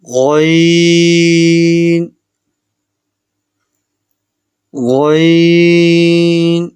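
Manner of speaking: غین held very long and heavy, mouth full; full-mouthed letter. Shown as a demonstration. Teacher demonstrating غین to students, long and full. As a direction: draw out غین full and thick. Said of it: "غین کو بھی آپ نے منہ بھر کر پڑھنا ہے".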